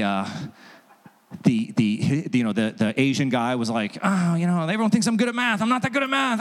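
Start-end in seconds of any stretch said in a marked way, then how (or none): 4.0-6.4 higher, whiny mock complaint.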